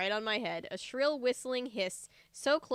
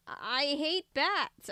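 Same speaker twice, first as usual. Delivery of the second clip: stammered; 5 semitones higher, 4 dB louder, much slower than usual. A narrator's reading. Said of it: "I stammered".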